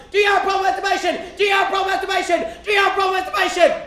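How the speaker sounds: high, relentless shouted interrogation; top-of-the-lungs yell. Retold or imitated. Church lady swirling the boy's head, yelling high and loud. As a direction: holler it over and over. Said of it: "yelling at the top of her lungs".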